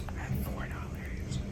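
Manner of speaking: breathy whispered confession. Real, not acted. Broke vlogger whispers her bank balance to viewers, defeated whisper. Venting about money to camera.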